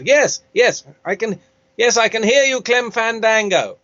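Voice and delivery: hammy voice-over actor, slowing at the end; Matt Berry. Acted. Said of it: "he's doing the radio thing".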